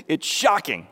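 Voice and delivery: ordinary talk, nothing marked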